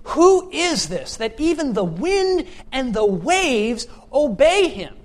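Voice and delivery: slow, high, emphatic wonder; awestruck disciples. Acted. Disciples on the boat after the storm, slow awed question. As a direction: draw out each key word in loud amazement. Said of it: "and they say".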